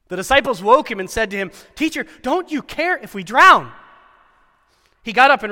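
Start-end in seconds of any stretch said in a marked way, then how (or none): 1.7-3.7 high, loud desperate plea; frightened plea. Acted. Disciples pleading with sleeping Jesus, frightened. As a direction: beg him to wake up and help.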